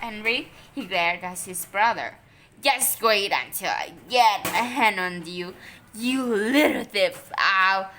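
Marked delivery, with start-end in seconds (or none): 2.6-7.9 loud menacing threat. A narrator's reading. Boy threatening his brother with menace. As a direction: growl it at the little thief.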